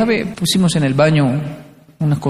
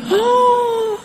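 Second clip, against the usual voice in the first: very high, drawn-out shocked "ah"; indignant gasp. Retold or imitated. Latinos reacting to being accused, mock shocked gasp. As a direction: draw in a theatrical gasp of outrage.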